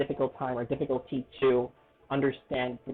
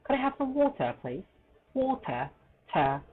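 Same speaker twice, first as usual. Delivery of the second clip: high-pitched British polite request; British demo, "water" ending in ta; British accent; 11 semitones higher than usual. Shown as a demonstration. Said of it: "But in British accent".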